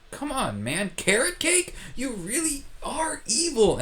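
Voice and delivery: high, whining outrage; high-pitched mock indignation; whiny complaint. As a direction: whine like a kid handed the wrong dessert. Luke disappointed by carrot cake.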